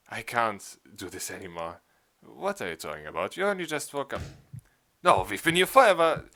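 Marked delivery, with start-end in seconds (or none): 5.0-6.3 loud, high despairing cry; despairing shout. Acted. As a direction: cry out in hopeless protest. Despairing argument with friends.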